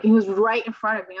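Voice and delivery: usual speaking voice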